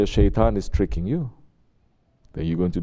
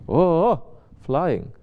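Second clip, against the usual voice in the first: mock delight; 7 semitones higher, much slower than usual. Women flattered by compliments.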